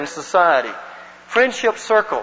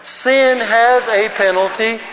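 slow, higher, measured recitation; group recitation lead; 4 semitones higher, slower than usual. Shown as a demonstration. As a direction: declaim it slowly so all can join in. Leads group reciting in unison.

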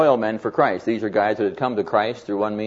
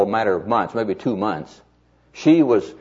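ordinary delivery

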